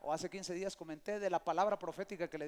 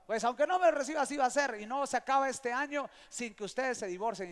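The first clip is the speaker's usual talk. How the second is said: louder, higher imitation of arguing; combative retort; 4 semitones higher, 9 dB louder than usual. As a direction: fire back as if in a fight, imitated. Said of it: "como que fuera pelea".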